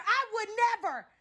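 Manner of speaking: defiant shout. Acted. Refusing to be robbed.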